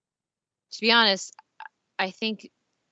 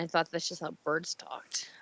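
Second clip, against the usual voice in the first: whisper; 10 dB quieter than usual. Real, not acted. Deadpan joke whispered.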